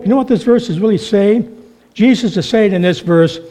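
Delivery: ordinary talk, nothing marked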